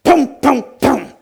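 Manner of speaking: three loud, high "pum" thumps; sudden loud blow sounds; imitated punch noises. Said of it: "without thinking, I went".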